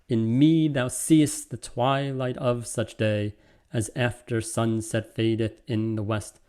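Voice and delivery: slow, regular iambic reading; slow sonnet scansion. Shown as a demonstration. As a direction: read the sonnet beat by beat. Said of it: "conventionally iambic pentameter".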